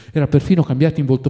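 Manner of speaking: plain reading voice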